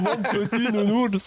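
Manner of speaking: slow, high cooing mother; doting mother voice. Acted. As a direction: coo it slowly like a mother.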